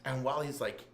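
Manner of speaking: ordinary talk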